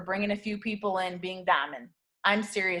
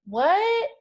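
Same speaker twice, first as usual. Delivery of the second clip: high rising puzzled what; newbie's bewildered what; confused what; 10 semitones higher, 5 dB louder than usual. Pretend. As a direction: sound baffled, pitch shooting up. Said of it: "you're like".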